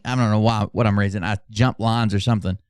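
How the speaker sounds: ordinary delivery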